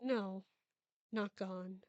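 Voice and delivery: ordinary delivery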